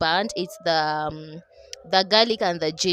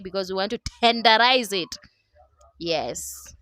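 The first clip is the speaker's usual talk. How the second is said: usual speaking voice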